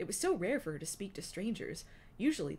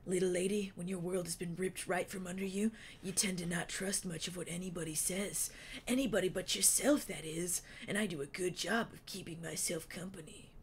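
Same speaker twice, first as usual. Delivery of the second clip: Wingtip's voice. A narrator's reading. Wingtip's held voice.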